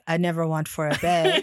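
deep, low voice, matter-of-fact; Ronan impression. Co-host showing how Ronan would say the line, deep Ronan impression. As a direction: drop it right down low. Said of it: "say it like Ronan and say".

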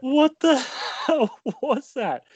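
high laughing outburst; laughing disbelief. Real, not acted. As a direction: laugh through the question.

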